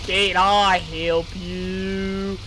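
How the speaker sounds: Can I help you, with YOU dragged out very slow and hammed up; mocking emphasis on you. Streamer mimics a shopkeeper NPC, heavy stress on you. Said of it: "put so much emphasis on you".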